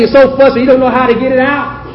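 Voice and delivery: normal talking voice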